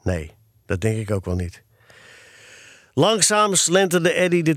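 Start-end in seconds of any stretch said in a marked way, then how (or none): 0.0-1.6 low, soft resignation; downcast, lower-pitched admission; sighing. A narrator's reading. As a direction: give in with a dejected sigh. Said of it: "want hij zuchtte".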